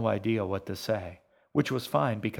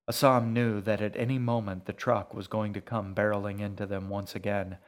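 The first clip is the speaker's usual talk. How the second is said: usual speaking voice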